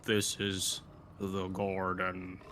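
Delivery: very slow, low drawl; slow-motion voice. Acted. Shrunken party hears the big one speak, in slow motion. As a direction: stretch every word as if in slow-mo. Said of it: "shouldn't your voice be like, with slow-mo".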